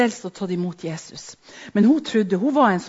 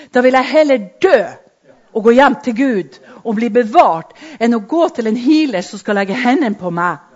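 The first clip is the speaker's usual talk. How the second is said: louder, driving sermon voice; forceful preaching; 2 semitones higher, 8 dB louder than usual. Real, not acted. Preacher declaring to the congregation, louder and driving. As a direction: pound it out like a vow.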